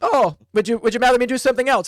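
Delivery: sugary threat while reaching for a mace; sweetly menacing. Acted.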